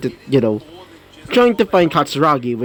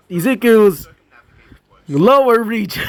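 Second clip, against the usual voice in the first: high, breaking into a laugh; giggly at the innuendo; amused; 8 semitones higher than usual. Real, not acted. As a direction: say it higher, about to crack up. Narrator cracks up at innuendo.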